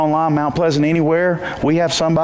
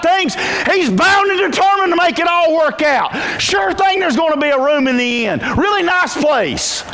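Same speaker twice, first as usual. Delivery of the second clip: preaching at full force; 13 semitones higher than usual. Acted. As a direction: build the sermon to a shout.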